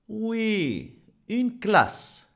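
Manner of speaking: ordinary talk, nothing marked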